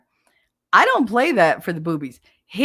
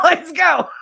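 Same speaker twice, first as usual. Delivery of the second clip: higher and louder, an eager let's go; eager mock shout; 10 semitones higher, 6 dB louder than usual. Partner eagerly agreeing, loud and high. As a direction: bark it out like you can't wait.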